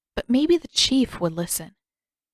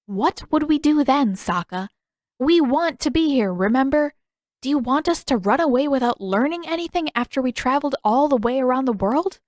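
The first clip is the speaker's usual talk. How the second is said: higher, louder, indignant; exasperated scolding; 6 semitones higher, 4 dB louder than usual. A narrator's reading. Katara scolds Sokka for wanting to escape, exasperated. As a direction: scold him for being foolish.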